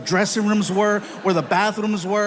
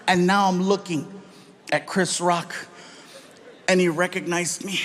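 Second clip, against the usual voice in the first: ordinary talk, nothing marked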